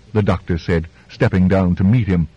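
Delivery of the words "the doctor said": plain reading voice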